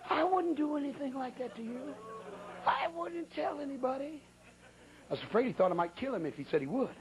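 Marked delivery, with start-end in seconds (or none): 0.1-4.2 mock pleading promise. Friend promises secrecy, afraid.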